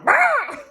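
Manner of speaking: high-pitched imitation crow squawk; crow caw. Speaker imitating an awesome crow, raucous caw. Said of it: "awesome crow going".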